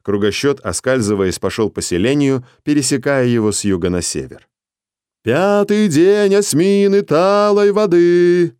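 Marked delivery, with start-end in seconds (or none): 5.3-8.5 high, slow, loud town crier call; ringing call. Acted. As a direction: call it out across the village. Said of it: "зычно выкрикивал Кругосчёт".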